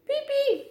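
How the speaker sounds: very high, squeaky imitation of a mouse, not quite convincing; a cat doing a poor mouse squeak; clumsy mouse squeak. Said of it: "zawołał niezbyt udatnie, naśladując dźwięk".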